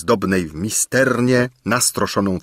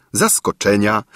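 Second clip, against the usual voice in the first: ordinary delivery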